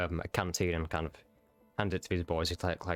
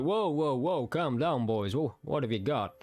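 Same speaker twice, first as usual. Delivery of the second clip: calming officer; 5 semitones higher, slower than usual. Acted. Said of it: "And he turns, it's like".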